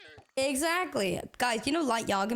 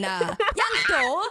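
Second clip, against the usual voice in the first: put-on Welsh accent; 3 semitones higher, 4 dB louder than usual. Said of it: "you do the Welsh accent".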